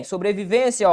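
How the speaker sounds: usual speaking voice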